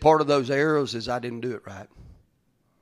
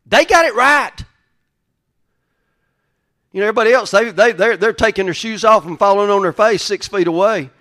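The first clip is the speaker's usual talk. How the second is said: loud, high preaching; fired-up sermon delivery; 8 semitones higher, 12 dB louder than usual. Acted. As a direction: preach it out full force. Preaching at full force.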